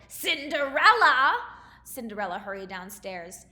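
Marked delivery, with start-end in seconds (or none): 0.1-1.3 slow, much louder yell of the name; harsh stepmother shout; stepmother's shout. A narrator's reading. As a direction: bellow up the stairs. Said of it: "her stepmother shouted".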